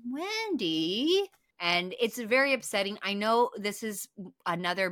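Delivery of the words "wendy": high, rising disbelieving call; exasperated Wendy. Retold or imitated. Host groaning at the character on screen, exasperated. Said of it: "I'm like".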